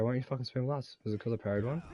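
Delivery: ordinary talk, nothing marked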